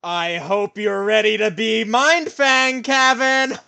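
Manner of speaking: slow, loud game-show announcement; announcer shout. Real, not acted. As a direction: boom it out like a host. Announces the final challenge.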